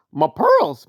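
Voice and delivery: lightly voiced pearl-clutching lady; a hint of a shocked Southern belle; mock fussy lady. Quoting his skit, a light pearl-clutching lady. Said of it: "infamous ... kind of skit".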